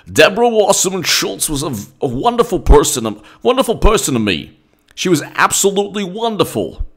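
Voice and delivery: hammed-up Bernie gushing over an ally; Bernie impression. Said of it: "Bernie will be like".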